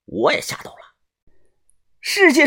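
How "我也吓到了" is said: ordinary delivery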